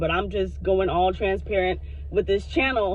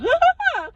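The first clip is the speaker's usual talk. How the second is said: high bright laugh; a real laugh bursting out; burst of laughter; 4 dB louder than usual. Real, not acted. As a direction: laugh out loud.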